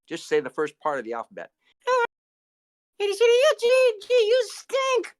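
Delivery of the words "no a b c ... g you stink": high puppet voice. Acted. Puppet recites alphabet.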